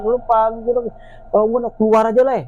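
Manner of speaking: imitated shouting, kept short of full volume; retold fed-up yell. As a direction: act out yelling back in annoyance. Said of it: "saya teriak-teriak".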